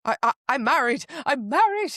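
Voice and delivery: high, loud stammered insistence; hammed-up flustered protest; mock protest. Acts out flustered married heroine.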